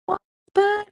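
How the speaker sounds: put-on whiny kid excuse.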